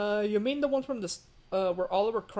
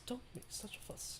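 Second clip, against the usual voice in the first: whisper; 5 semitones higher, 16 dB quieter than usual. Retold or imitated. Repeating a character line.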